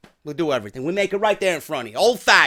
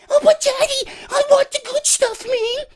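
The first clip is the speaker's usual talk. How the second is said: very high squeaky protest; whining kid; 3 dB louder than usual. Acted. Kid protesting to daddy about ice cream, squeaky whine. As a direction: whine in a falsetto child voice. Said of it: "and you got the kids".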